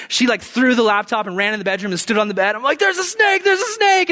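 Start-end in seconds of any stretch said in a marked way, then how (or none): 2.8-4.1 high, frantic repeated alarm; acted panic. Husband warning his wife about the snake, high-pitched panic. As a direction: shriek the warning in comic terror.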